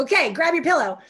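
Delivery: upbeat coaching order; bright command. Retold or imitated. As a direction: push her to grab it now. Coach giving instruction.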